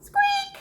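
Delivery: loud, high mouse squeak; a sudden shrill squeak. Acted. Squeak bursts out as the noise returns.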